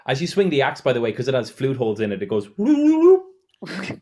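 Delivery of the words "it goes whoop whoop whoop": whistling axe. Acted. Axe with flute holes whistling.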